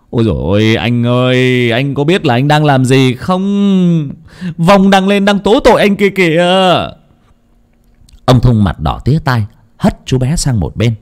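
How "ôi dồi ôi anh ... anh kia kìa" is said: high, urgent plea to stop; alarmed pleading. A narrator's reading. Boy pulling the husband back, pleading in alarm. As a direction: beg him to see what he's doing.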